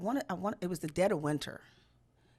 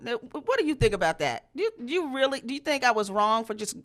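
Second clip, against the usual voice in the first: ordinary delivery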